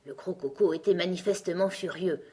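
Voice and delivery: ordinary delivery, nothing marked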